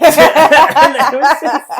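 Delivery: laughing through words; cracking up mid-sentence. Real, not acted. Laughing while recalling.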